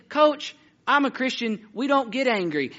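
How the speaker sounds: put-on high, slow kid voice; earnest boy. Church boy explaining himself to his coach, innocently earnest. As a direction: imitate a child patiently explaining himself.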